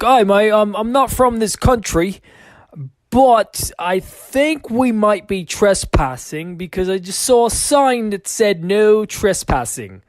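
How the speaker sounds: lightly voiced Aussie guy, Australian twang; Australian accent. Aussie warning the group about trespassing, mock Australian accent. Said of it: "he's like".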